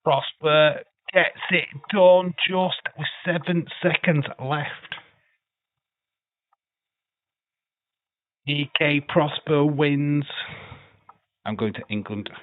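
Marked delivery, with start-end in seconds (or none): 0.0-10.2 excited play-by-play, higher and louder; live wrestling commentary. Acted. Streamer calls the wrestling match he's watching, excited play-by-play. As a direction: call the bout like a ringside commentator.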